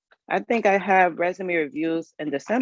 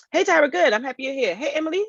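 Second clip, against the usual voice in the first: excited greeting; 9 semitones higher than usual. Real, not acted. Greeting viewers joining.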